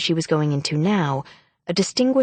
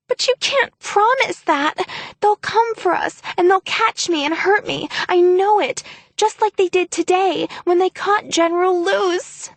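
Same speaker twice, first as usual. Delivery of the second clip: sputtering; 13 semitones higher than usual. Acted. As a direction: stumble through it in staged fear. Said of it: "Anais sputtered".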